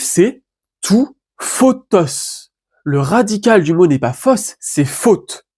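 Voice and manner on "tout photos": photos drawn out slowly, syllable by syllable; slow spelled-out genitive. Shown as a demonstration. Teacher modelling the genitive photos for viewers, slow and careful. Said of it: "et son génitif".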